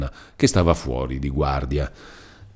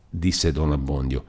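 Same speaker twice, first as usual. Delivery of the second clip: ordinary delivery, nothing marked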